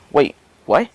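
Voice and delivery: sudden startled question; shocked. Retold or imitated. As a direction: caught off guard, mock shock. She's shocked he deflects it.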